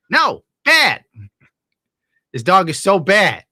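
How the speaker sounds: exasperated shout at a pet; loud scolding. Real, not acted. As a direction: scold the dog at full volume. Scolding the barking dog.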